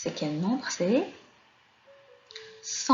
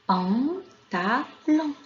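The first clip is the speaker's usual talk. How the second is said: PANTALON stretched out very slowly, higher, each syllable separate; 5 semitones higher, 4 dB louder, much slower than usual. Shown as a demonstration. Teacher sounding out the first picture's word for children, Pantalon syllable by syllable. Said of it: "Quelle est la syllabe".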